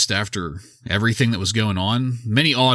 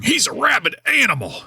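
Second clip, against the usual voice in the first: high-pitched frantic shouted commentary; Jim Ross; 3 dB louder than usual. Retold or imitated. Host quotes the commentator on a surprise attack, mock shouting. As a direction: holler it like an outraged ringside announcer, imitating. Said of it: "Jim Ross was shouting".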